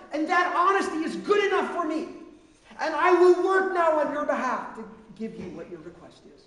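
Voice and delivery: loud, high, driving sermon voice; a preacher pressing the point about doubt, loud and high; preaching at full force. Acted. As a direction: preach it hard to the congregation. Preacher voices Jesus and exhorts.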